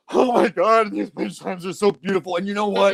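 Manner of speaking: lightly mocked gushing emotional guy; Vin Diesel. Actor marvels at gifted wind chimes, mock gushing. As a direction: imitate someone overcome by a small gift. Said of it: "he's walking through the woods going".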